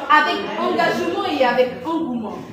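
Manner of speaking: usual speaking voice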